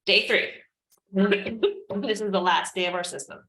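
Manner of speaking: ordinary delivery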